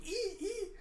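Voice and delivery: very high-pitched "ee, ee" squeals; fangirl squeal. Acted. Becky fanatical over the dreamy picture, fangirl squealing. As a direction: squeal like a smitten fan. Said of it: "fanatical Becky's going with an".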